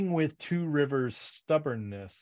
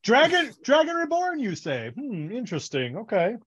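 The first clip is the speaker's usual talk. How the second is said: much higher, sarcastic curiosity; mock feigned interest; 7 semitones higher, 5 dB louder than usual. Rand pretending to care about the Dragon, mock interest. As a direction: ham up the fake fascination.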